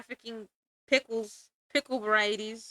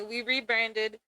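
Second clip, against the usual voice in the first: ordinary talk, nothing marked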